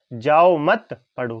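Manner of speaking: जाओ मत said as one loud, drawn-out unit, then पढ़ो; pause after मत. Shown as a demonstration. Teacher quoting father's order to child, pause after मत. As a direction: break the phrase right after मत. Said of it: "पिताजी कह रहे हैं बच्चे से".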